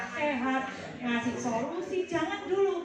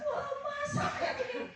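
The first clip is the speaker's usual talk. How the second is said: whiny complaint; 11 semitones higher than usual. Acted. Client voiced complaining, laughing.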